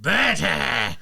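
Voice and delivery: big cartoon henchman calling his own name; hammed-up battle cry; Burter. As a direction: shout it like a showy villain's roll call. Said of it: "his big blue friend".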